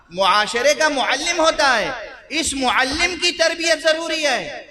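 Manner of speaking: high, rising pulpit declamation; insistent appeal from the pulpit; emphatic preaching. Real, not acted. Preacher drives home his point.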